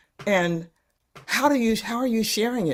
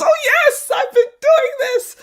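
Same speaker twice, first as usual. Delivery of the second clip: eager mock exclaim; 8 dB louder than usual. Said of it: "someone who says".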